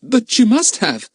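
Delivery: put-on high, pleading boy's voice; boy desperately insisting. A narrator's reading. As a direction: beg him to believe. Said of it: "said Philip desperately".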